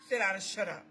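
suddenly low and soft after preaching; low, understated order; hushed repeat of the command. Acted. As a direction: drop right down, quiet and firm. Drops to an aside after shout.

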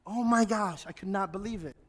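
usual speaking voice

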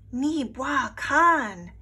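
slow call. A narrator's reading. Snow speaks a command.

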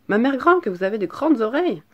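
put-on high, loud child's exclamation; astonished little girl. A narrator's reading. Girl amazed by grandmother's ears, high astonished voice. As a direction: burst out in high-pitched wonder. Girl marvels more strongly.

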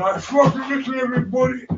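high, tearful and breaking; crying declaration. Real, not acted. Telling his brother he loves him, crying and high. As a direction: pour out love through tears.

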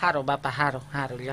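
a timid granny agreeing meekly; meek old mother. Retold or imitated. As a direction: grandmother thanking the village doctor, low and soft. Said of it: "બા બિચારી પૂછે".

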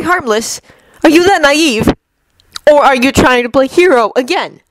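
mock his naivety; sneering Loki. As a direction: Loki scoffing at Stark. Loki voiced by reader.

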